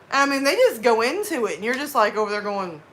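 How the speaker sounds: usual speaking voice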